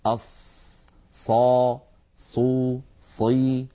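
أص hissed, then صا صو صي; sad with its vowels. Shown as a demonstration. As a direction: run through أص صا صو صي clearly. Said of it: "في مجرى ضيق".

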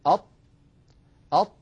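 أط stopped hard, loud, the tongue sealed to the palate; emphatic ta demo. Shown as a demonstration. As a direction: strike أط firmly. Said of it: "جربوا هكذا". (أط بإطباق وقوة)

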